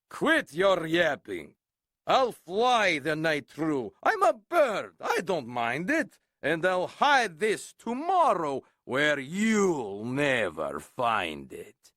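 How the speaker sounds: rough eagle voice, sneering; eagle calling back. Acted. Eagle taunting Horton from the air, sneering. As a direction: taunt over your shoulder. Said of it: "called back".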